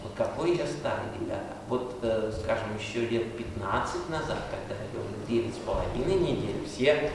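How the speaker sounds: usual speaking voice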